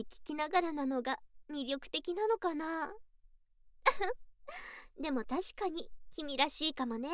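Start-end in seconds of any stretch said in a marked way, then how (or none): 3.8-5.0 high teasing giggle; mocking giggle. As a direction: laugh at him, amused. Mocking laugh.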